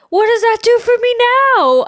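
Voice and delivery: a near-yell of frustration at mom; frustrated outburst. Retold or imitated. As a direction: throw the question back at mom, exasperated. Retold outburst at her mom.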